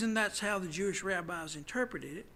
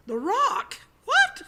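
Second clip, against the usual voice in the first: The rock? What? squeaked much higher and louder; mock astonished cry, much higher and louder; mock disbelief; 10 dB louder, slower than usual. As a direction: play up the incredulity, voice jumping way up. Mocking the rabbis' interpretation.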